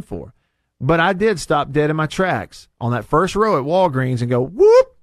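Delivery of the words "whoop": high, loud comic whoop; startled whoop. Retold or imitated. Host acting out stopping dead at the Pringles, startled whoop. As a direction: let out a sudden swooping whoop.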